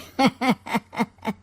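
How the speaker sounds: villain laugh. Acted.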